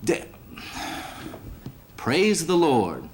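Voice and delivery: a hammed-up slow praise the Lord; kid catches himself. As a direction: bite back the curse, then say it slow and proud. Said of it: "the little kid looks up to the sky and he goes".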